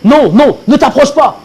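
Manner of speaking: very high, loud panicked shriek; screaming in horror at the leper; terrified scream. Acted. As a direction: shriek to keep him away. Leper approaching him.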